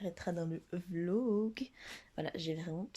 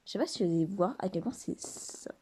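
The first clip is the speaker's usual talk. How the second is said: usual speaking voice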